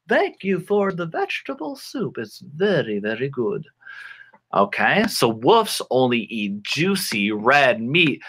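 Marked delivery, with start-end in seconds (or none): 0.1-3.7 lightly acted grandmother, starting high and sinking. Grandmother thanking for the vegetable soup, put-on granny voice. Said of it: "grandmother is gonna be like".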